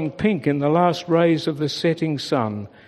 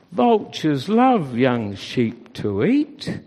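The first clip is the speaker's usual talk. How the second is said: ordinary delivery, nothing marked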